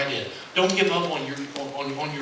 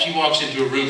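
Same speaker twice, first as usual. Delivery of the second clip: normal talking voice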